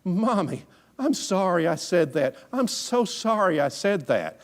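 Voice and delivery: high, pleading mock cry; imitated crying child. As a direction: apologise like a little boy melting down. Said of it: "he began to cry".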